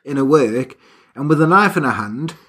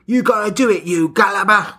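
lightly done Australian insult; a hint of a bossy Australian wife; mock Australian wife; 3 semitones higher than usual. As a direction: demand it, Australian slang.

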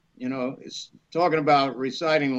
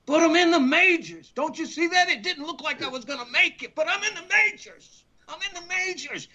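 much higher, thrilled pleading; retold excited pleading; 12 semitones higher than usual. To his agent, a high thrilled plea. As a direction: beg like a kid who made the big leagues.

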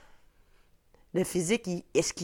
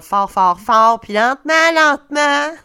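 put-on booming, drawn-out talk to an old lady; exaggerated loud slow patronizing voice; 7 semitones higher, 14 dB louder than usual. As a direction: talk down loudly and slowly like a condescending nurse. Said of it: "Il parle comme ça".

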